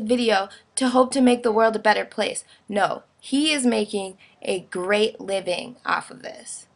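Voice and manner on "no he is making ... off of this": usual speaking voice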